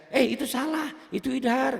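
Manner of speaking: high-pitched quoted correction; animated high retelling of a parent's scolding; parent correcting children. Retold or imitated. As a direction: act out a parent catching the children's mistake.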